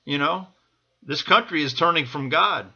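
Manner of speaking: usual speaking voice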